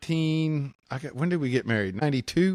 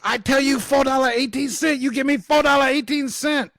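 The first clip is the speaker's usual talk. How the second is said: high, loud mock Chinese accent shouting; mimicking an angry Chinese cashier's accent, much higher and louder; yelling with a Chinese accent; 13 semitones higher, 6 dB louder than usual. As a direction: yell it like the shop lady through the window, in broken Chinese-accented English. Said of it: "this Chinese lady is yelling at me".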